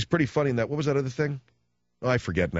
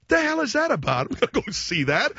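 ordinary talk

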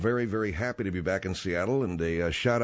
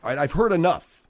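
louder, higher, fed-up interruption; exasperated; 7 semitones higher, 6 dB louder than usual. Acted. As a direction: cut them off, you've had enough. Host cuts off the guests.

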